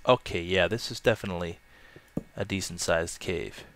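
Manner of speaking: ordinary delivery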